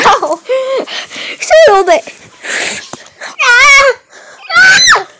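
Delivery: usual speaking voice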